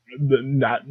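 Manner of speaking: ordinary talk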